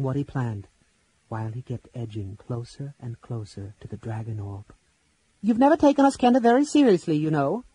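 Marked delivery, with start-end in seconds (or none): 5.4-7.6 high, loud, fast nervous kender; shrill kender voice. Acted. Tas addressing the lords while edging toward the orb, shrill. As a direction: squeak it out, scared but bold. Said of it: "his voice shrill".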